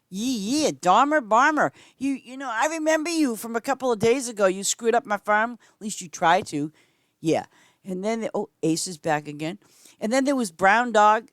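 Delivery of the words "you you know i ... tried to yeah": ordinary talk, nothing marked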